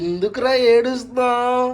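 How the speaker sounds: slow, high, drawn-out coo. Real, not acted. Consoling a crying child.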